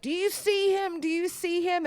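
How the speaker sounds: high-pitched, frantic retold child; mock child's scream. Small son to mother about the closet, mock panicked squeal. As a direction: imitate a panicked little boy, very high but not shouted. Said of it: "just starts screaming and pointing toward the closet".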